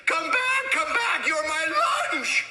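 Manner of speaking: high, chasing call; calling after him. Acted. Auntie May chasing the pancake down the road, calling high.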